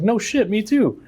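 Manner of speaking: high, delighted no way; mock-thrilled at a fellow veteran; excited surprise. Retelling meeting a fellow vet, pitched high excited.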